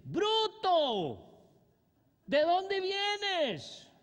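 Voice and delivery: high, loud, drawn-out mock yell at the son; father yelling insult. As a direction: scream it like an angry father, stretching the last words. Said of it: "les grita".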